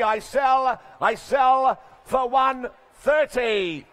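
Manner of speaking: loud, drawn-out final call before the hammer; closing call. Real, not acted. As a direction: stretch out the sale like the hammer is falling. Auctioneer bringing down the hammer.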